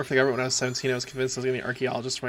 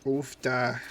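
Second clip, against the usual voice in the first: Minnesotan oofta. Retold or imitated. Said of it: "My grandma used to say it".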